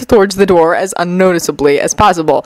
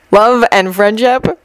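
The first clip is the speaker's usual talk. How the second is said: doubtful echo; 3 semitones higher, slower than usual. Acted. Haruhi echoes her tarot reading, slow and doubtful. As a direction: repeat it slowly as a sceptical question.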